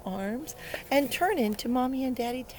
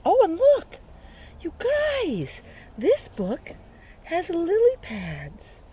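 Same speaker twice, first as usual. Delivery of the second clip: excited; 9 semitones higher, 7 dB louder, slower than usual. Real, not acted. Excited discovery in book.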